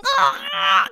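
high loud shriek; high scream. Acted. The imp shrieks in fury as he sinks.

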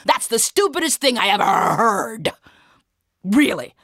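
loud, high, derisive soldier; laughing mockery. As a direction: jeer through hammed-up laughter. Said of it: "the soldier started laughing".

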